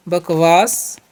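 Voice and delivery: ordinary talk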